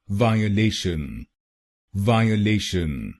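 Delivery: वायलेशन said slowly as "vaa-ye-le-shan"; correct pronunciation. Shown as a demonstration. Teacher putting the word back together, careful pronunciation. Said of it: "तो बनेगा वायलेशन".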